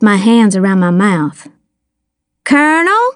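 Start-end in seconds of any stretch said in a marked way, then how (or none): 2.4-3.0 high call with a rising end; mild mock call for someone out back. A narrator's reading. Girl calls out so Star can hear. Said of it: "I called so Star could hear me".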